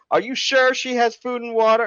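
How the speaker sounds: very high-pitched mock lady; imitated yell. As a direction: pester them in a high, doubting call. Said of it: "she yells at us".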